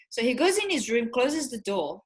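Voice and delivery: ordinary talk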